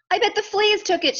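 high, loud storybook shout; excited duck's shout, high and loud. A narrator's reading. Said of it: "shouted the duck".

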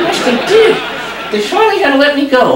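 high, whiny puppet voice; stubborn little dummy refusing; squeaky ventriloquist dummy. Acted. Dummy tells joke.

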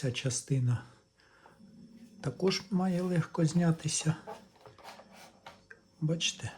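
ordinary talk, nothing marked

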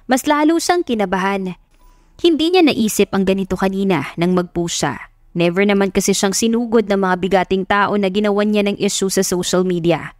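usual speaking voice

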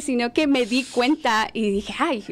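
normal talking voice

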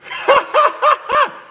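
high, rapid giggling laugh; high cackle. Real, not acted. Laughing about her catfish hunt, high cackle.

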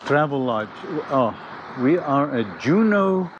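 ordinary delivery

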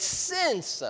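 since lifted high and stressed. Shown as a demonstration. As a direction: lift since high to mark certainty. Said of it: "we probably should better translate it".

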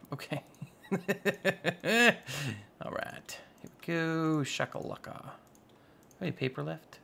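normal talking voice

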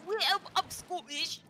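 slow, badly faked Scottish accent. Player asked for a Scottish accent, slow fake attempt. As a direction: have a shaky stab at sounding Scottish. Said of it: "[name], Scottish accent?".

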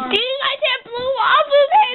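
very high, slow wailing shout; silly wailing shout. Pretend. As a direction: wail it out like a tantrum.

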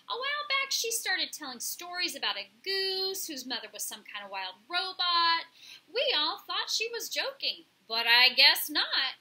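loud, high, brash bird voice; chatty pigeon. A narrator's reading. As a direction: gossip loudly as an excitable pigeon.

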